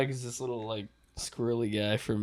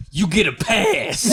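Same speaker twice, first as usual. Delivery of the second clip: slow, higher and louder, laughing; mock guard; 9 semitones higher, 7 dB louder, slower than usual. Guard lets the friend through, slow laughing. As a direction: grant the pass with mock gravity. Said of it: "he's like".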